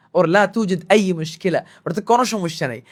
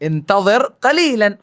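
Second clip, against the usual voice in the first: slow demo; much slower than usual. Shown as a demonstration. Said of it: "এটাকে আমরা কিভাবে বলবো?".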